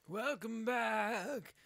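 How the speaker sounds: slow drawn-out announcer welcome; slow hammed-up host greeting; mock host. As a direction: stretch it like a cheesy TV host.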